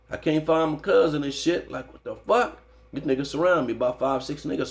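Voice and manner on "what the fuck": alarmed outburst. Retold or imitated. Surrounded by rivals, alarmed.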